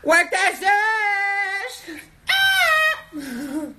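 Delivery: high sung-out hype yell; shouting. Real, not acted. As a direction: belt it out to hype her up. Cheering during wig try-on.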